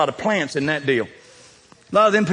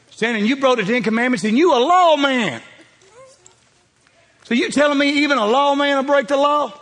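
higher, laughing, playful jab; teasing; 9 semitones higher, 3 dB louder than usual. Real, not acted. Preacher teases a lawman in the pews, laughing. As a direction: rib him with a laugh.

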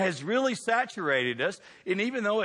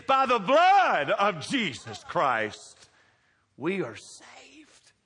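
slow emphatic preaching; 3 dB louder, much slower than usual. Acted. Preacher slowing for the key claim.

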